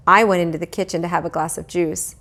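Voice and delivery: usual speaking voice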